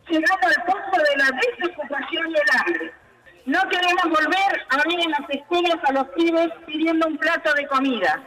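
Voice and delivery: fiery rally speech, shouted; rousing protest oratory; loud soapbox ranting. Real, not acted. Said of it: "brindó un discurso encendido".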